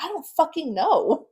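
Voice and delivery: retold outburst, high and loud; exasperated outburst. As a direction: throw it back, overwhelmed and exasperated. Snaps back at frantic questioner.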